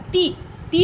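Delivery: "ती" said quickly, with a short vowel. Shown as a demonstration. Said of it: "थोड़ा जल्दी से बोला".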